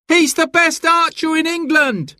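very high excited shout; crowd cry. A narrator's reading. A voice in the crowd recognizes Robin, excited shout. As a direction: gasp out his name to everyone.

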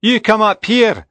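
high-pitched shouted summons; shouting. A narrator's reading. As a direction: call them up the hill, high and urgent. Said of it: "I shouted".

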